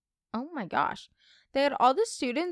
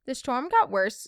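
usual speaking voice

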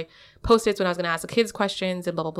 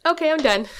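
higher-pitched mock teacher sign-off; retold blurted wrap-up, pitched up; abrupt sign-off; 8 semitones higher than usual. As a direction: imitate yourself abruptly ending a lesson. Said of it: "I literally would just be like".

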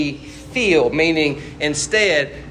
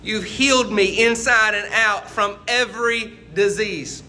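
raised-pitch reverent psalm reading, slowing; preaching the psalm with rising fervor; 6 semitones higher, slower than usual. Acted. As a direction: lift the voice and read it like praise.

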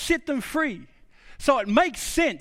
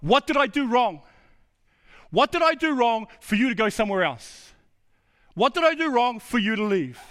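raised, emphatic pleading question; God questioning Israel; 2 semitones higher than usual. Acted. God asking Israel why they left, emphatic appeal. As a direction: press the question, drawing out each repeat. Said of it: "God's asking the nation of Israel".

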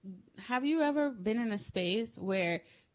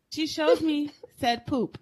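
usual speaking voice

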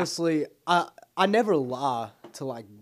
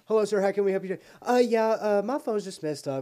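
higher, louder overly polite voice; higher, louder meek caller who was all talk before the call; 8 semitones higher, 5 dB louder than usual. Retold or imitated. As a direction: play the nervous caller who lost his nerve. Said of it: "Then be like".

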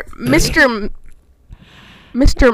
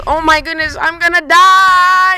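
mock panic; 4 semitones higher than usual. Danny sees the barge coming, mock panicked wail. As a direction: stretch the last word into a hammed-up cry. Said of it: "he just, he goes".

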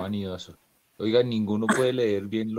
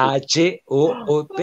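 K A H O O T spelled slowly and loudly, letter by letter; loud, spaced-out letters in K A H O O T; corrective spelling; 5 semitones higher, 6 dB louder than usual. Shown as a demonstration. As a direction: spell out K A H O O T firmly to correct someone. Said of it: "es con H".

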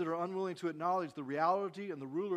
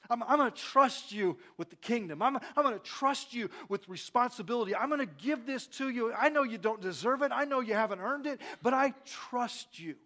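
higher, insistent preaching in God's words; impassioned assurance; 5 semitones higher, 4 dB louder than usual. Acted. God promising trust to undeserving people, impassioned. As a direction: press each promise, slowing on the last.

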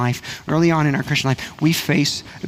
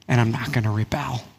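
usual speaking voice

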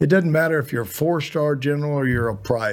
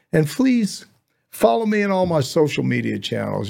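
usual speaking voice

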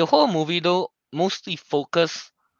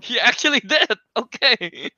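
laughing; 10 semitones higher than usual. Retold or imitated. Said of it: "I'm like".

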